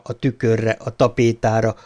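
plain reading voice

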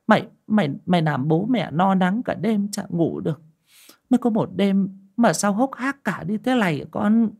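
high, wailing mother's voice; old mother wailing as she touches her son's face; whimpering lament. Acted. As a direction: cry out to the son who came home late. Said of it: "miệng mếu máo".

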